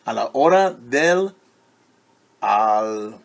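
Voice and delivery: lively picture-book read-aloud; reading to children. A narrator's reading. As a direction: read it brightly to a class of kids.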